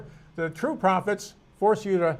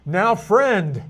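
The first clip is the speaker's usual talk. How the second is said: louder mock-condescending address; 7 dB louder than usual. Teacher voicing the rebuke to workers who wanted more, condescending. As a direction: put weight on it, talking down to a so-called friend. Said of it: "like in a condescending manner".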